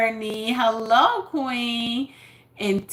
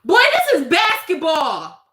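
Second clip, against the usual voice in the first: exasperated outburst; 6 semitones higher, 6 dB louder than usual. Real, not acted. Coach exasperated at kid.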